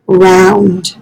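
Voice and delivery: usual speaking voice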